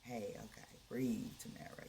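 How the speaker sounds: soft, fast self-soothing; telling herself to breathe; calming herself. Real, not acted. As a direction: talk yourself down. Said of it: "bringing myself down out of performance energy".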